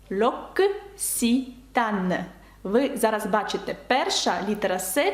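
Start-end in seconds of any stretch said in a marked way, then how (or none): 0.1-2.2 slow French model. Shown as a demonstration. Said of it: "Ця марка читається як".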